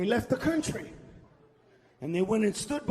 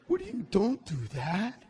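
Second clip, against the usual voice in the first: ordinary talk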